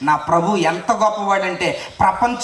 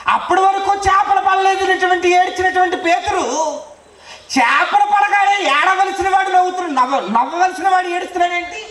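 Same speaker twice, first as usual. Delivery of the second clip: high, loud preaching voice; fiery preaching; 9 semitones higher, 3 dB louder than usual. Acted. Preacher marvelling to the crowd at Peter's tears, fiery. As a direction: proclaim the wonder with full force.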